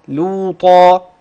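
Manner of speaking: لوطا drawn out slowly, each sound held; teacher spells out لوطا slowly for the student to hear the thin lam; slow demo of the word. Shown as a demonstration. Teacher to student, showing the lam, slow careful recitation. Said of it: "لاحظت في صوت اللام إيه؟".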